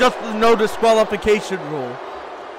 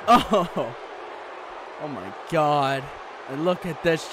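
low, subdued commentary; hushed dismay; 9 semitones lower, 8 dB quieter than usual. Real, not acted. Commentator watches a helpless wrestler, low and dismayed. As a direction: voice sunk in dread.

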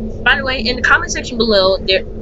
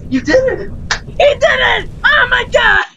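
excited cheering; 13 semitones higher than usual. Real, not acted. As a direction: celebrate the win wildly. Friend beats the night.

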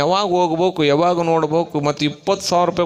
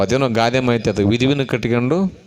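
ordinary talk

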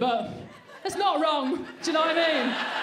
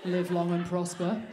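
low, slow, deadpan Spock; Spock impression; 8 semitones lower, slower than usual. Comic playing Spock to the crowd, low and slow. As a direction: intone it like Spock. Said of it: "love child of Sue Perkins and Spock".

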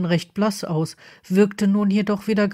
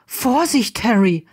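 sharp call of warning, higher start; 4 semitones higher, 4 dB louder than usual. Acted. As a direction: call out to stop him. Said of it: "rief Draco da".

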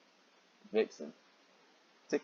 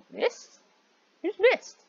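confused question; 5 dB louder, much slower than usual. Acted. Character confused by a name.

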